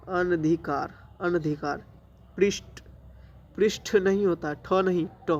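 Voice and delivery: ordinary delivery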